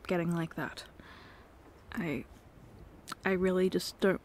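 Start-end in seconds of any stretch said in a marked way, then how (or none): none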